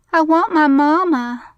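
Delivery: put-on high, loud childish wail; whimpering little boy. A narrator's reading. Little boy clinging to his father's neck, whimpering. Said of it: "the boy whimpered".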